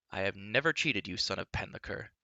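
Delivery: ordinary delivery, nothing marked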